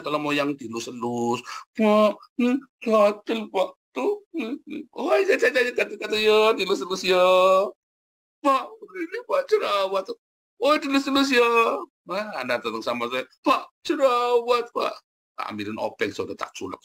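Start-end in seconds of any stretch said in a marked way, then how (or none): 1.8-11.5 loud, exaggerated high-pitched fussing; whiny complainer. Acting out congregant wanting to be coddled. 13.5-14.9 high, drawn-out mock whining; loud, exaggerated high-pitched fussing; whiny complainer. Mimicking congregant fussing over a pimple.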